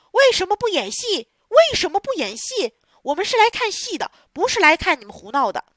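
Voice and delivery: loud, high, indignant shouting; angry crowd yelling. Acted. Theatre crowd shouting at the stage, indignant and loud. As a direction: yell it like a cheated audience demanding the show. Audience yelling at puppet show.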